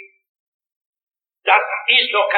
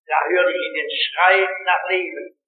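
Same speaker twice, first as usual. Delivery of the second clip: ordinary talk, nothing marked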